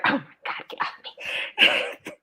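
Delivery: whispered, dragged-out "get off me"; whispered plea. Retold or imitated. Mother telling kids touching her all at once, whispered. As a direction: hiss it slowly under the breath, overwhelmed. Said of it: "I'm like".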